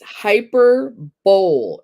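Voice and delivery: HYPERBOLE read as two words, hyper plus bowl; mispronounced HYPERBOLE. Real, not acted. Host misreading a quiz question, hyperbole as "hyper-bowl". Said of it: "I've been saying it wrong".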